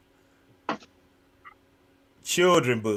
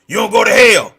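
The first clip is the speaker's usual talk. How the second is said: loud, higher mock fire-and-brimstone; preacher's condemning shout; 5 semitones higher, 12 dB louder than usual. Mimicking church folk greeting street youths, loud condemning shout. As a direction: blast it out like an instant condemnation.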